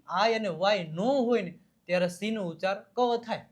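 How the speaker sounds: usual speaking voice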